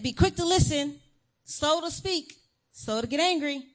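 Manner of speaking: put-on teacher repeating the verse to chattering kids; insistent repeat. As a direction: drill the verse at them with rising impatience. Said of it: "I said".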